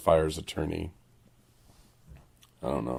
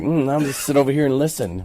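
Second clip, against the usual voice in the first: higher, coy little voice; sneaky listener; 7 semitones higher than usual. Retold or imitated. Host imitating a woman secretly listening in, coy higher voice.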